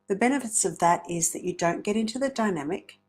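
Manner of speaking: usual speaking voice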